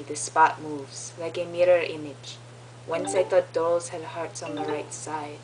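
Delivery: measured poem reading, Romanian accent. Shown as a demonstration. Reading a friend's poem aloud, Romanian accent. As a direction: recite it with an attempted Romanian accent. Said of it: "my next accent shall be Romanian".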